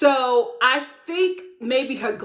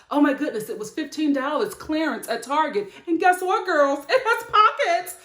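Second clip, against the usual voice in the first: excited woman showing off a dress bargain; gush, rising high on the big reveal; 4 semitones higher than usual. Retold or imitated. Any woman telling her girls about her dress, gushing.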